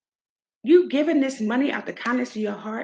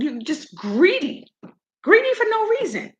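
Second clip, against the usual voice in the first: high-pitched outraged scolding; real fired-up outrage; heated; 10 semitones higher than usual. Real, not acted. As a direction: call them out, voice soaring in indignation. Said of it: "I'm heated".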